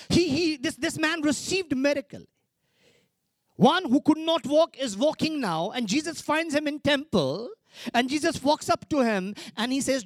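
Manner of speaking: high, loud preaching climax; impassioned sermon peak; rising preaching. Real, not acted. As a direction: lift the crowd with the story.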